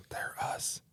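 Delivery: hushed, slow whisper; whispered aside. Real, not acted. Host confiding that the praised blog writers are themselves, whispered. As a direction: whisper it like a confession.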